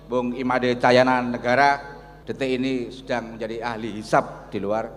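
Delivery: ordinary talk, nothing marked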